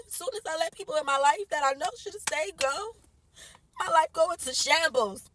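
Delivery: half-laughing through tears; crying. Real, not acted. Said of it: "My kids see me crying".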